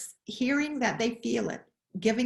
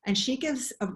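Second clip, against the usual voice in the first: usual speaking voice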